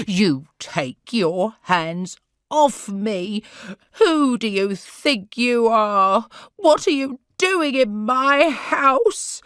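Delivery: loud furious shout, slow; angry shout. A narrator's reading. Sarah suddenly shouts at Corrie to let go, furious. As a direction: yell at the intruder. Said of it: "she shouted".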